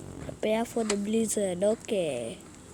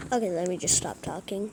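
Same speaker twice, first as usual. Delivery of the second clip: ordinary talk, nothing marked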